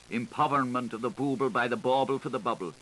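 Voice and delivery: ordinary delivery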